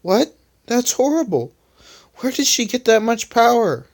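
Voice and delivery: mild worry. Acted. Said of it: "asked Rubber worriedly".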